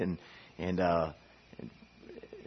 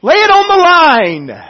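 high, loud battle exhortation; general rallying troops; 11 semitones higher, 8 dB louder than usual. Acted. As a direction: rouse soldiers before battle. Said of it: "the general would stand before his soldiers".

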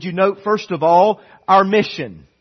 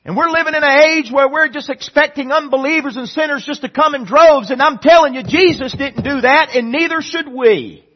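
higher, louder, driving fast at the start; preaching at full force; 8 semitones higher, 6 dB louder than usual. Real, not acted. Preacher challenging the church on outreach, forceful. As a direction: press it like a rousing sermon.

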